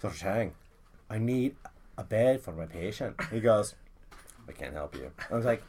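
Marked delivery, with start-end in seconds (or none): 0.0-3.2 low, soft, measured plea; low earnest request. Retold or imitated. Caller asks doctor for a hospital bed, low and soft. 4.5-5.1 very low, very quiet refusal; refusing doctor. Retold or imitated. Doctor on the phone refusing a bed, low quiet voice.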